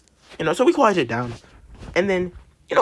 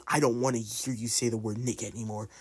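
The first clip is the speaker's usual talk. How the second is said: much softer, lower quote; imitated hushed warning; 5 semitones lower, 10 dB quieter than usual. Student repeating teacher's whispered warning, hushed and low. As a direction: sink to a hush for the teacher's words. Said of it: "dead ass whispers in my ear".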